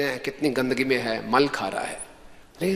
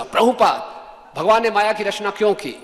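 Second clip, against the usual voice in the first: louder, higher-pitched retold question; raised voice; 5 semitones higher, 3 dB louder than usual. Disciple repeating his unheard question to Prabhupada, raised voice. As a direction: call it out so he hears this time. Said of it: "तो जोर से बोला".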